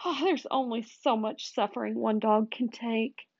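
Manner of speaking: high, hammed-up moaning; moaning lament. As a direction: moan it melodramatically. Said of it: "roll onto my back and moan".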